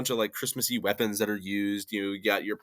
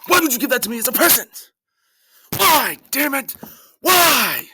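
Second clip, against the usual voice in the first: loud, much higher, fast shouting; mock outrage; 9 dB louder than usual. Friend opening a hated gift, hammed-up screaming. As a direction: yell in hammed-up betrayal.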